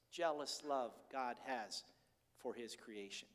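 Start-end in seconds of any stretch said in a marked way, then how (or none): none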